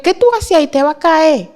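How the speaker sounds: high, loud startled cry at the window; sudden frightened alarm, pitched high. Retold or imitated. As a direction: jolt awake in alarm.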